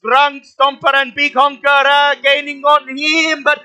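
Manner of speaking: loud, high play-by-play call; excited commentator calling the race; race announcer. Acted.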